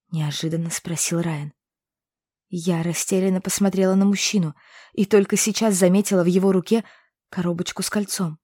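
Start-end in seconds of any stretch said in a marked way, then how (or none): none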